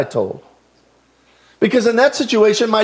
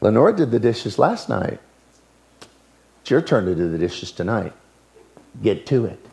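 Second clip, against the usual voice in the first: much lower, softer voice of a stern father; stern dad; 9 semitones lower, 6 dB quieter than usual. Retold or imitated. A father sternly orders his daughter to the dishes, low and quiet. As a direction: drop low and quiet, leaving no room to argue.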